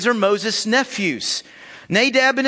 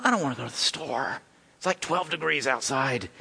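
much softer, lower, fast lazy grumble; grumbling priest; 7 semitones lower, 8 dB quieter than usual. Pretend. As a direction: mutter like someone dreading the cold. Imagined Abihu whining.

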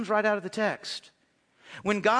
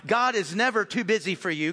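ordinary delivery

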